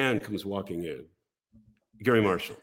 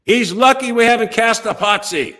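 high, loud yell from a back office; imitating an exasperated shout; retold scream; 10 semitones higher, 6 dB louder than usual. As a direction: belt it out from down the hall. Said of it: "screaming out from one of the back offices".

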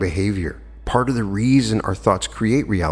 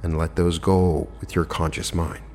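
calm relaxation lead with long pauses; guided meditation; 3 dB quieter than usual. Acted. Host talking listeners into letting go of tension, guided meditation voice. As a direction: guide them gently into rest, unhurried and slightly softened.

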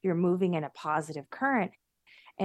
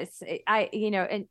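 normal talking voice

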